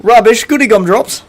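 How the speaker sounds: hammed-up British accent; mock British accent. Shown as a demonstration. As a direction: exclaim like a cartoon Englishman. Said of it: "What about British?".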